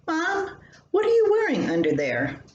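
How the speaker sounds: put-on a high, quick little-girl voice that swoops down; child's question. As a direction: play the curious seven-year-old pressing her mom. Child pesters mom about robe.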